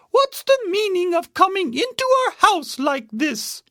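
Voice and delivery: high-pitched mother bear; scolding Mama Bear. A narrator's reading. Mama Bear confronts Goldilocks.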